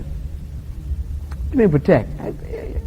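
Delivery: slow, hushed whisper, almost no voice. Retold or imitated. Girlfriend pushing back on the guy, whispering suspiciously. Girl mocked in retold exchange.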